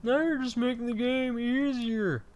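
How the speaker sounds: high-pitched mock whiner; mocking, high-voiced complaint; complaining viewer. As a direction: imitate a nagging viewer in a put-on high voice. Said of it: "if you guys are saying".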